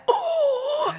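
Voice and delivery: sudden high laughing gasp of shock. Retold or imitated. Retelling catching her ex out, shocked laughing oh. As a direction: yelp in shocked delight. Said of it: "And I was like".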